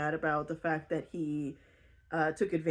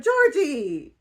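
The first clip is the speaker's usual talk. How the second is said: high, loud, slow incredulous lecture to a friend; pleading with Georgie, high, loud and exasperated; exasperated scolding; 6 semitones higher, 5 dB louder than usual. Retold or imitated. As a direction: scold her like a friend who made a terrible choice. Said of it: "And I was like".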